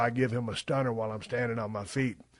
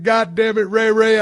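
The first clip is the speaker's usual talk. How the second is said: raised, louder mock scolding; retold loud teasing; 7 semitones higher, 8 dB louder than usual. Ribbing Rey Mysterio about the Rumble spot, loud and high. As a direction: rib him loud and high.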